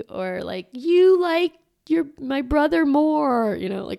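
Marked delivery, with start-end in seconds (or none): 0.7-3.6 put-on high, slow kid whine; jealous child. Parent voicing a jealous child, high and slow.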